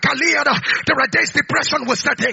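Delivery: fervent preaching. Acted. Preacher exhorts believers not to let prayer die: urgent preaching. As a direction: press the point home.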